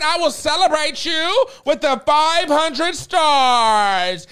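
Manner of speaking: slow, high proclamation; grand announcement. Retold or imitated. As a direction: proclaim it like a herald. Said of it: "Greg said".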